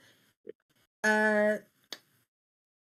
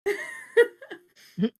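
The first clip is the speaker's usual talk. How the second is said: laughing; 7 dB louder than usual. Real, not acted. Laughing while recounting hiding.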